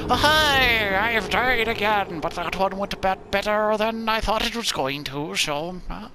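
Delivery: high, bright, chirpy greeting; cheerful chirpy voice. Pretend. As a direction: sound perkily cheerful about dying.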